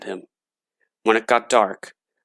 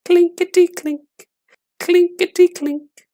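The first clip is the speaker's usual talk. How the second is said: very high, tinny clinking voice; coins rattling; 4 dB louder than usual. A narrator's reading. Coins rattling in the gravedigger's tin box, high clinking voice. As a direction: ring it out like tiny silver coins. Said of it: "it went".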